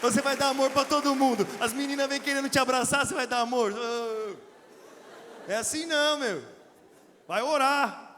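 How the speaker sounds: loud, driving preacher's exhortation; preaching at full force; forceful preaching. Acted. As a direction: preach it hard at the crowd.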